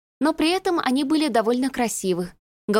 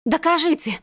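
higher, heated challenge; heated demand; 7 semitones higher than usual. A narrator's reading. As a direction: fling the demand at him. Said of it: "Всё больше распалялась она". (возмущённо, требовательно)

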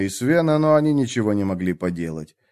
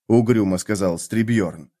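usual speaking voice

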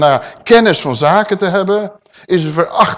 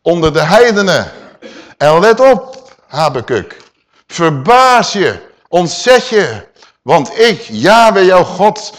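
loud, commanding divine proclamation; voice of God; 2 semitones higher, 6 dB louder, slower than usual. Acted. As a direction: speak as God to Habakkuk. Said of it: "zegt God". (luid gebiedend als God)

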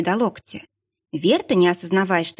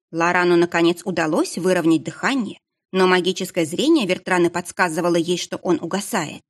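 ordinary delivery

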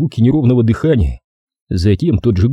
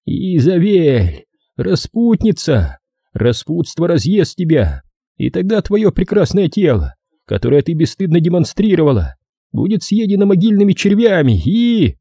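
menacing caller; 8 semitones higher than usual. A narrator's reading. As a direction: chant the accusation high and ominously. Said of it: "Уже знакомый голос, внушающий суеверный страх".

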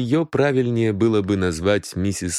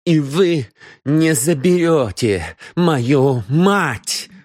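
raised, slow menace; threatening; 6 semitones higher, 3 dB louder, much slower than usual. A narrator's reading. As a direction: warn him off your mother. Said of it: "угрожающе добавила". (Мот угрожающе предупреждает сыщика)

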